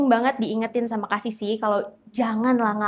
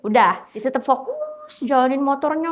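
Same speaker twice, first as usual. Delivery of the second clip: ordinary delivery, nothing marked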